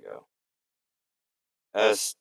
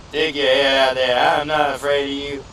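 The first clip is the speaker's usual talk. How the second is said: louder challenging call; loud taunt; 4 semitones higher, 7 dB louder than usual. Pretend. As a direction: dare them out loud. Taunting an enemy.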